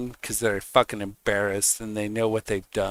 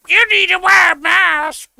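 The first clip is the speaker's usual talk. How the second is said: much higher, loud, whiny put-on voice; mocking mask nag; 10 dB louder than usual. Mocking a creep on the street who demands masks, in a shrill whine. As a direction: squeal it like a preachy mask scold. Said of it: "a creep on the streets that's like".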